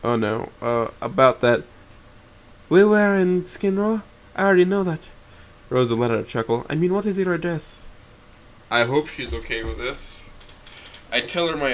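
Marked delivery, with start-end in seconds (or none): 2.7-5.1 light teasing woman's voice; amused woman. A narrator's reading. 6.7-7.9 higher, lightly voiced young woman; light woman's voice; Rosa's voice. A narrator's reading. Rosa voiced.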